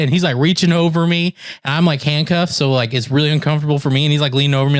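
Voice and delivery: ordinary delivery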